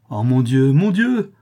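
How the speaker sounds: loud exclamation leaping very high on the repeat; appalled cry. A narrator's reading. Prince hearing the countess may live, appalled cry. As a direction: cry out, stricken and aghast. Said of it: "s'écria le prince atterré".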